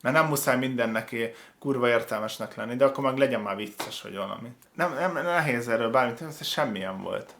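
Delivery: ordinary talk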